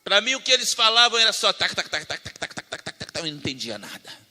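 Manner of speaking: usual speaking voice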